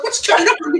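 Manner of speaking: high, puzzled mentor's question. Retold or imitated. Mentor baffled by his partner's panic, high puzzled asking. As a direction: ask it like you've no clue what's happening.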